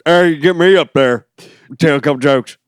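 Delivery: put-on old guy voice. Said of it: "that old guy voice you do".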